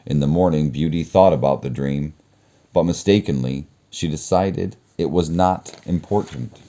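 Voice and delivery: ordinary delivery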